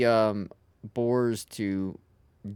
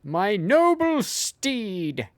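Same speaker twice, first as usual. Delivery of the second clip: slow, high, grand knight voice; 8 semitones higher, 3 dB louder, much slower than usual. Pretend. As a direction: speak like a noble knight. Mock knightly speech again.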